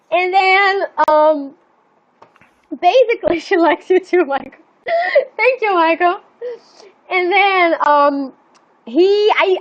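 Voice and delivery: helpless giggle breaking into the words; laughing. Real, not acted. Breaks up laughing mid-story.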